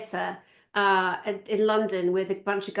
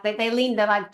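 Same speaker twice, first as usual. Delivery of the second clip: ordinary talk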